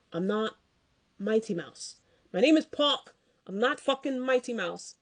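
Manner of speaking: put-on fan putting on the accent for an angry in-ring retort; Pac's accent. Imitating a wrestler's accent for a furious wrestler's retort. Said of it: "I'm trying to do his accent".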